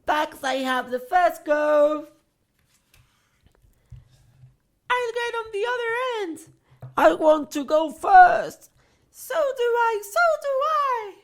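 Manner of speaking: put-on very high, squeaky kids; eager children. A narrator's reading. Children squabbling over who rides first, squeaky. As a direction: clamour to go first. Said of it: "shouting with excitement".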